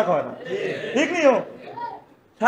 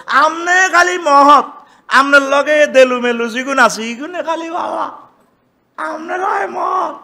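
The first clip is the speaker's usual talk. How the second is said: high sarcastic jeer; mocking taunt; 5 semitones higher, 4 dB louder than usual. The people taunting Shuaib, high sarcastic jeer. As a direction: taunt him as holier-than-thou. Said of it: "কারে গালি দিছে?".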